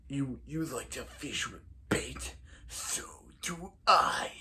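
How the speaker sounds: a hushed, lightly voiced menacing villain; Zoom impression. Zoom taunting Barry about fishing with bait, in a hushed voice. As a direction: taunt him softly, a self-admitted weak Zoom. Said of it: "I don't do a very good Zoom impression".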